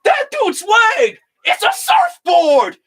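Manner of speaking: high, loud, slow astonished shout; bartender shouting. Retold or imitated. Bartender shocked at Obi-Wan's boast, loud astonished shout. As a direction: holler it in disbelief. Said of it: "The bartender's like".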